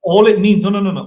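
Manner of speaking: usual speaking voice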